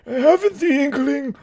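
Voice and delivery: put-on high, halting French-accented voice; mock French accent. Confused Frenchman answering a request, high mock French accent. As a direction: stumble along in a silly French accent.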